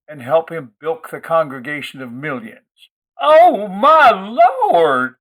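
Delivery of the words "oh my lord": put-on high, loud, very slow screech in a phony Southern accent; screeching Southern belle. Gwendy mocking Lloyd down the school hall, shrill fake Southern screech. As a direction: shriek it like a scandalized church lady. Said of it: "she screeched all the way down the hall".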